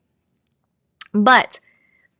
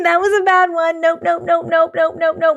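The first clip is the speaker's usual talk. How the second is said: spooked refusal; 7 semitones higher than usual. Real, not acted. Recoiling from the scary story.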